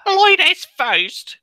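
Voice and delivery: high, drawn-out mock British; Antoine's British accent. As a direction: stretch it out in a loud, hammed-up British accent. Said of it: "ladies first in Antoine's accent".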